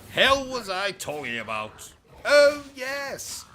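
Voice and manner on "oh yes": high, loud exclamation of remembering; theatrical, high "aha" moment; sudden recollection. Acted. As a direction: pipe up as it comes back to you. Suddenly remembers what he was saying.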